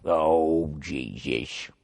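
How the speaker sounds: low, quiet disgusted groan. Real, not acted. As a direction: groan in disbelief. Reacting to creepy email.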